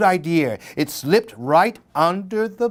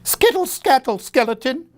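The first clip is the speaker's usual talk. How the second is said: much higher shout, scared; frightened shout; 9 semitones higher than usual. A narrator's reading. Old lady in the tree shouts high and scared at the skeleton. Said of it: "shouted the little old lady".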